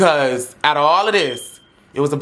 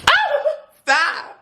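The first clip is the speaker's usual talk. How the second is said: startled squeal. Retold or imitated. Groped during the pat-down.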